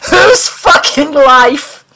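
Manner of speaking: angry demand. Acted. Mulder demands an answer from the alien, slow and furious. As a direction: drag it out in outraged fury.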